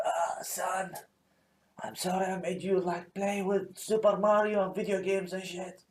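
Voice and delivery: soft, feeble deathbed apology; dying father. Acted. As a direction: apologize faintly, as if on your deathbed.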